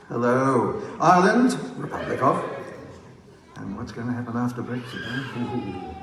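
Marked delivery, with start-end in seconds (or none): 1.0-1.6 high, loud, rising announcement; calling out. Real, not acted. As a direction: call it out with a lift at the end. Roll call of countries to crowd.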